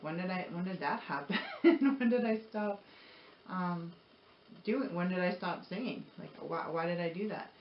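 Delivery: ordinary talk, nothing marked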